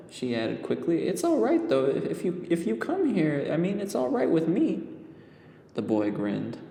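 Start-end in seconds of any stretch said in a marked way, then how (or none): 1.1-4.8 put-on high, stammering young girl; nervous little girl reassuring the boy; girl character voice. A narrator's reading. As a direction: stumble over the words, flustered.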